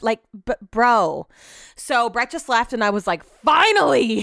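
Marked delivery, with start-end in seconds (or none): 3.2-4.2 loud, higher burst of relief; exasperated relief. Acted. Wife once husband just left, loudly relieved. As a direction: let it out like a long-awaited release.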